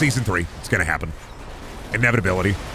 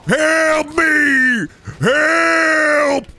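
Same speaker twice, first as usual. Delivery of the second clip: much higher, louder desperate yells; hammed-up trapped cry for help; screaming for help; 5 dB louder, much slower than usual. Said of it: "That's what I'm gonna sound like tomorrow".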